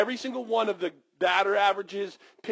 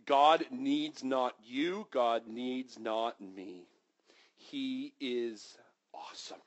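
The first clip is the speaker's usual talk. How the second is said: reverent hush; 5 semitones lower, 6 dB quieter, much slower than usual. Real, not acted. Reverent slow emphasis.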